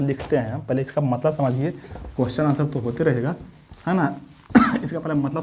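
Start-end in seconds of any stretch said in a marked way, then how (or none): none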